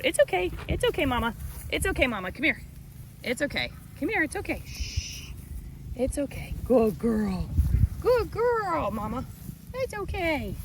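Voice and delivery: high, soft cooing to a nervous animal; soothing goat. Real, not acted. Farmer calms her mama goat while filming underneath, soft high cooing.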